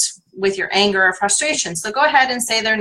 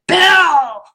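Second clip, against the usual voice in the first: loud, higher furious call of the name; frustrated shout of the name; 8 semitones higher, 6 dB louder than usual. Shown as a demonstration. Tapping coach shows how to shout the name in frustration. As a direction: yell the name at someone who let you down. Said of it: "pretend you're really talking to them and you're so frustrated".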